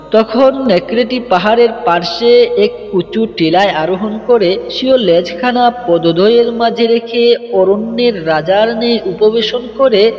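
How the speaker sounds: plain reading voice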